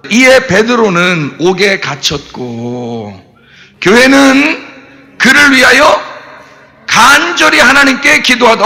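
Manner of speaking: slow, deliberate Bible reading; scripture reading. Acted. Preacher reading Acts to the congregation, slow solemn reading. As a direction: read the verse out slowly and solemnly. Reads scripture verse aloud.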